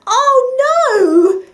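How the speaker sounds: high, loud wail of dismay. A narrator's reading. Fox realises his uncle comes tonight, wailing. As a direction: cry out in despair. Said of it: "he wailed".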